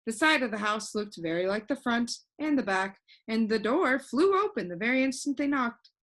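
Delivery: ordinary delivery, nothing marked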